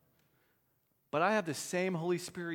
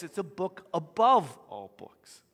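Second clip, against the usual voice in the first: emphatic; 8 dB louder than usual. Real, not acted. Climax of sermon point.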